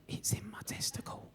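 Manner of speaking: soft unvoiced whisper; embarrassed patient whispering to the receptionist. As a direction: lean in and whisper it. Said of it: "I whispered".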